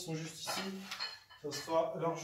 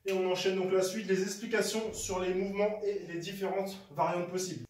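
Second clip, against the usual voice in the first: ordinary delivery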